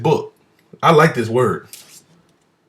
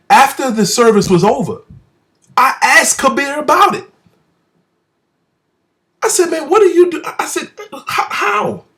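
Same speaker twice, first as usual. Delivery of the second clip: amazed asking; 11 semitones higher than usual. Retold or imitated. Excited retelling of asking Kabir.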